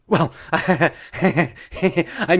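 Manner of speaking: slow, stalling little laugh between words; chuckling. Acted. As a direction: chuckle through the start of the line. Nervous chuckle inside narrated dialogue.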